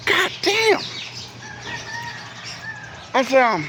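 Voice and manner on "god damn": high exasperated mock exclaim; shocked. Narrator reacting to the attendant at his car, high shocked exclaim. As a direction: blurt it out in disbelief, pitched up.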